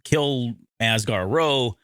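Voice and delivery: ordinary delivery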